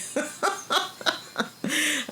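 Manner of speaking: a long, high giggle; high giggling laugh. Real, not acted. Vlogger giggling at her own goofy voice, high laugh.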